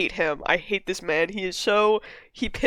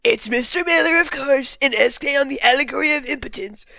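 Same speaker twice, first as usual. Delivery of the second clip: higher, hammed-up Trump impression reading an essay; 5 semitones higher than usual. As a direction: read it like Trump giving a speech. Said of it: "I'm gonna read it in a Trump voice".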